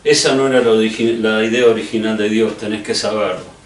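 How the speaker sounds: usual speaking voice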